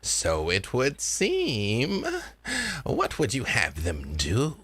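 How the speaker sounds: slow, knowing spirit elder; Granny. Acted. Granny asking the girl to command the spirits, slow and knowing. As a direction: draw it out with a swooping lilt. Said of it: "Granny's voice".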